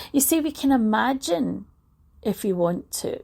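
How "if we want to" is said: ordinary delivery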